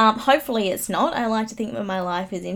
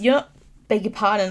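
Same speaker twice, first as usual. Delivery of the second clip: usual speaking voice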